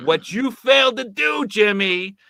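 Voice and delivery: angry call-out building up; heated rant. Real, not acted. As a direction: tear into him for failing. Ranting at Jimmy, rising anger.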